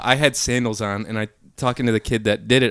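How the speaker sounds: ordinary talk, nothing marked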